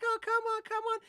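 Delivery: high pleading imitation of her; high mock stripper voice. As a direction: coax him in a high, whiny voice. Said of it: "She's like".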